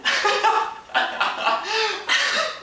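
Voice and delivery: high, drawn-out laugh. Acted. Storyteller laughing that the guy let go, high laughter. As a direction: crack up at your own punchline.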